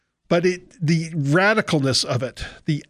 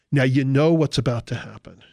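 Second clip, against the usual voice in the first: usual speaking voice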